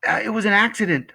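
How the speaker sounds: high, quick excuse; sickened boy. Acted. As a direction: blurt it out like an upset kid. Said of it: "sickened by what had happened".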